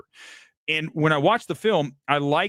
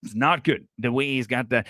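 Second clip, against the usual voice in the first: ordinary talk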